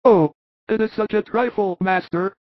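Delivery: high, earnest apostle voice; Thomas's voice. Acted. Thomas proposing a plan to the apostles, high, steady voice.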